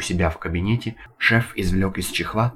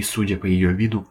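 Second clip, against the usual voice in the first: usual speaking voice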